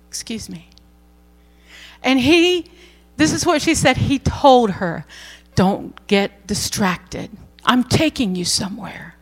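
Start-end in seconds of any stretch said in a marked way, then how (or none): 0.1-0.7 very soft, hushed interruption; a near-whisper, ever so gentle; gentle divine voice. Retold or imitated. God's voice interrupting her texting. 5.6-9.1 gentle but clear. Retold or imitated. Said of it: "He did this so very gently, but ever so clearly".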